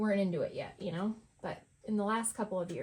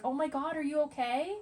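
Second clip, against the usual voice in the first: mock alarmed concern, pitched higher; worried coworker; 6 semitones higher than usual. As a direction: play the fussing colleague, a bit hammed-up. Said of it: "they're like".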